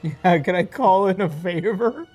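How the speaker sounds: usual speaking voice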